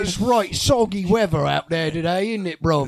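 loud mock British small talk; British accent. Friends trading fake British accents, loud and hammed-up. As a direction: ham up a Londoner chatting about weather. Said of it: "if you go to London".

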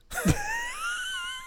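high wild cackling laugh; shrieking laugh. Real, not acted. Big laugh.

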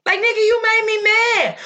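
high-pitched exasperated comeback; heated, high outburst at an ex; indignant retort. Retold or imitated. Imagined angry retort to the ex.

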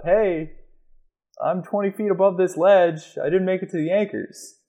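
high-pitched mock shout up to partners; calling up. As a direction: call up to climbers overhead, half-hearted volume. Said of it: "you gotta shout up".

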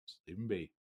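quiet echo. Retold or imitated. Repeating order in low voice.

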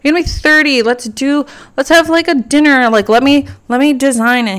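high, pushy excited plea; excited pleading. Retold or imitated. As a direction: talk him into a party, eagerly. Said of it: "I'm like".

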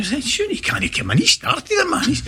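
high, slow, half-laughing protest; panicked protest. Retold or imitated. Players fearing the manager after the brawl, high laughing panic. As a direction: squeak out the worry, laughing through it.

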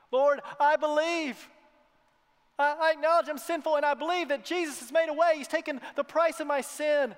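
loud, impassioned confession of faith; fervent declaration. Acted. Preacher voicing the beggar, loud and fervent. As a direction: cry it out like the healed beggar.

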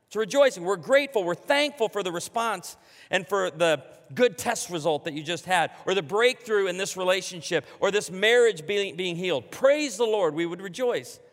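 ordinary talk, nothing marked

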